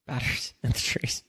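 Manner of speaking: whispered echo. Real, not acted. Host whispers back his cohost's joke in a whisper. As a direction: breathe the words back in a whisper. Echoing friend's line.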